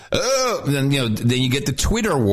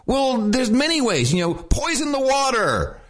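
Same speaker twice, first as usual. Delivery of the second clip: excited shout; 9 semitones higher than usual. Real, not acted. Ranting about ending the war.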